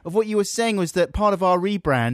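high, protesting complaint; mock indignant. Co-host told it's not political phone-in, mock indignant. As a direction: protest at a high pitch as if badly let down.

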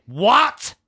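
high, loud, slow furious rant of a bossy woman; hammed-up rage at being told off; aggressive matriarch. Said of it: "It got really aggressive really fast".

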